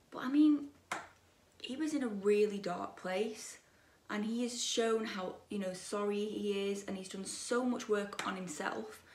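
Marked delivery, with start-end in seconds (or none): none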